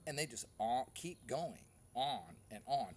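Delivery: ordinary talk, nothing marked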